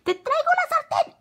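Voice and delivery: put-on very high, loud girlish voice; insistent girl. A narrator's reading. Carmela insisting at the door, high insistent yell. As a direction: insist in a piercing child's yell. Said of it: "insistió Carmela".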